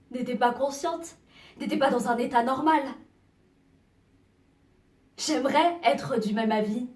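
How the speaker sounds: building from loud to a high shout; anguished protest. Acted. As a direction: let the protest swell into crying out. Protests against excuse of madness. (protestation qui monte jusqu'au cri)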